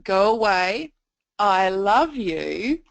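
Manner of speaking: put-on adult steering the child's choice; leading inflection. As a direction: linger on "I love you" to hint at it. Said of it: "inflection of the voice".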